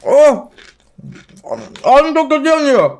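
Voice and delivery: high, loud frustrated outburst; exasperated shouting. Real, not acted. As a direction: yell in disbelief at the screen. Unknown character, must eat chips.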